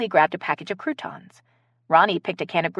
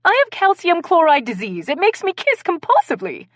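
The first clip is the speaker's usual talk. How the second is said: put-on high, louder English-accented excuse; 11 semitones higher, 5 dB louder than usual. A narrator's reading. Accused guest invents a kissing disease, English accent. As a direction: plead theatrically in an English accent. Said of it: "with an English accent".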